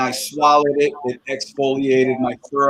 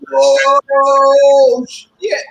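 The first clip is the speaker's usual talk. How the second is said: mock shocked yelp; 10 semitones higher, 6 dB louder than usual. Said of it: "get up and be like".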